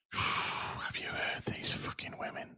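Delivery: sigh, then whisper it in mock disbelief; hushed, voiceless aside. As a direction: breath-only whisper after a sigh. Repeats the line in a whisper.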